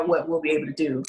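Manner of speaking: normal talking voice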